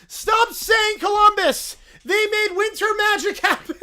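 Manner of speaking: high, loud indignant bear shout; broken-English bear. Acted. Little Brown Bear protesting at the screen, high and loud. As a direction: protest like an upset toddler.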